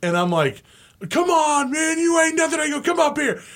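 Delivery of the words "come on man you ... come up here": high taunting yell. Retold or imitated. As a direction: goad him to come up. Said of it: "I just start going off".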